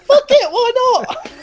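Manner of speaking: very high, slow silly squeal; squeaky mock exclamation. Host shrugging off tonight's problems, squeaky and silly. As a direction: squeal it with reckless abandon.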